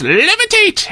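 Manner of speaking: put-on high old man's yelp; old man shouting to escape a trap; shouted spell. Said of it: "the old man shouts".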